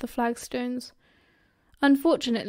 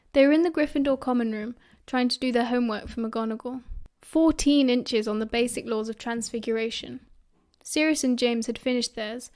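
usual speaking voice

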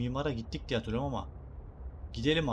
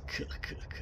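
sneaky giggle; 7 dB quieter, slower than usual. Pretend. Sneaking into base.

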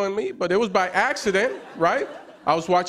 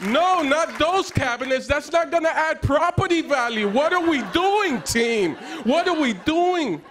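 high-pitched, worked-up coach rant; 10 semitones higher than usual. Retold or imitated. Coaching the house hunters on TV, worked up. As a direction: plead with the team like a fan. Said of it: "I was yelling at the T V like it was a football game".

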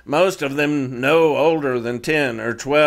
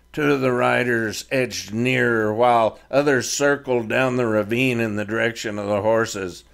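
ordinary delivery

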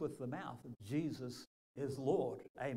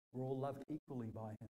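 usual speaking voice

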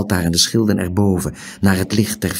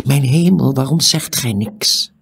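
high, loud exasperation; exasperated; 7 semitones higher, 5 dB louder than usual. A narrator's reading. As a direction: burst out in urgent frustration. Stranger exasperated by Tiuri's silence.

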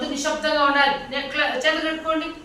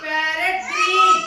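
slow rising model; 4 semitones higher, slower than usual. Shown as a demonstration. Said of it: "చిలక పచ్చ చూడండి".

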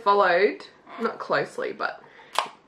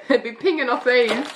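laughing; 3 semitones higher than usual. Real, not acted. Laughing after label read.

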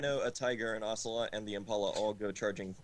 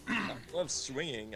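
mild exclamation; 5 semitones higher, slower than usual. A narrator's reading. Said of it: "I exclaimed".

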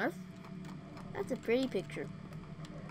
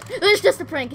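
defensive shout; 8 semitones higher, 14 dB louder than usual. Real, not acted. Defending herself loudly.

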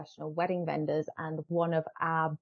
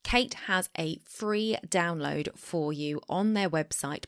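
ordinary talk

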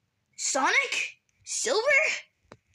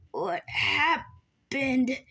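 dazed whisper; much slower than usual. Acted. Waking up confused.

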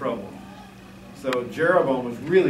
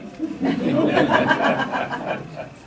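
high breathless laugh; real laugh; 12 semitones higher than usual. Real, not acted. Preacher laughing at his own turkey line, real laugh. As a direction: break into a high airy laugh.

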